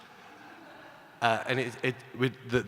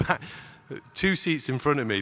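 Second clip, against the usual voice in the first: normal talking voice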